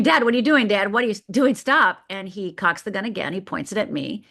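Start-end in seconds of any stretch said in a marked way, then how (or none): 0.0-2.0 loud, high panicked yelling at dad; retold scream. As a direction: scream it out as a terrified teen. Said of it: "And I start screaming".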